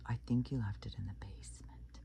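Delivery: creepy man whispering toward the basement; sinister whisper. Retold or imitated. Creepy homeowner luring him downstairs.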